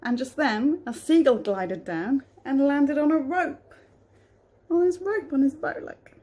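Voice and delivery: storybook reading voice; reading to children. A narrator's reading. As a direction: read it gently to a child. Reads a picture book aloud.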